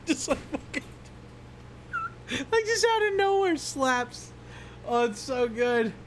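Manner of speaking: very high, words squeezed through laughs; real giggling breathlessness; helpless laughter. Real, not acted. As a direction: try to talk while cracking up. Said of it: "laughing at that so much".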